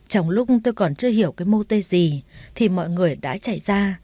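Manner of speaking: plain reading voice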